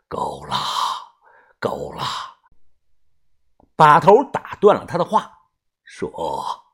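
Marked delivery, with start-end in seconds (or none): 0.1-2.0 低声喝止. Acted. 头目被吓到低声喝止.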